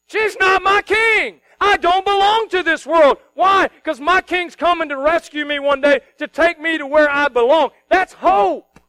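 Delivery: loud, high, slow fiery preaching; full-force pulpit declaration. Acted. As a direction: proclaim it to the whole room.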